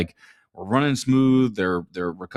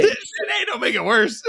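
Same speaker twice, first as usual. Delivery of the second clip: laughing protest; 14 semitones higher than usual. Real, not acted. Laughing protest about his age.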